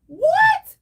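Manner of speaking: high, loud disbelieving what; shocked what. Real, not acted. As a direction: shoot it up in total disbelief. Disbelief at paying for repairs.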